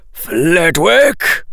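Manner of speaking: full-throated angry-sounding bellow; roar. A narrator's reading. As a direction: roar it out urgently. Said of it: "roared Professor Quirrell".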